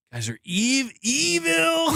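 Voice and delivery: evil stretched out high; mock accusation. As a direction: draw out EVIL in a high, put-on voice. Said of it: "that's where I'm like".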